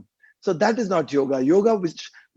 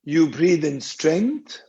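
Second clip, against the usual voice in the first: lower-pitched meditation voice with long pauses; guided meditation; 4 semitones lower than usual. Real, not acted. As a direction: guide listeners through each breath, drawing out the cues. Leads a guided breathing meditation.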